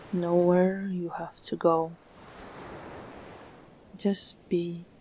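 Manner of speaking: calm meditation voice with long, unhurried pauses; soothing, evenly paced relaxation guidance; guided meditation. Acted. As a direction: guide the listener gently into stillness, phrase by phrase.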